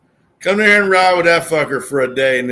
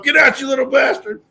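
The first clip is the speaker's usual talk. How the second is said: put-on high New York yell at kids; New York accent; 10 semitones higher than usual. Man kicking kids off a bus, in a New York accent. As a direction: bark it like a bad New Yorker impression. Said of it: "terrible impression of this New Yorker".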